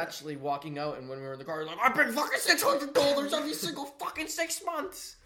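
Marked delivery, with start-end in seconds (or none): 1.8-5.2 much higher mock rant; hammed-up high rant; mocking imitation. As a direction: ape the friend's exaggerated complaint, high. Mimicking friend's car rant.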